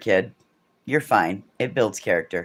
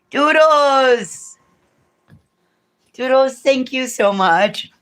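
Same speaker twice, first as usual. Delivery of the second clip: much higher, louder delighted shriek of thanks; excited squeal; 13 semitones higher, 4 dB louder, slower than usual. Real, not acted. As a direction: gush at the giver, thrilled. Thrilled to get a gift.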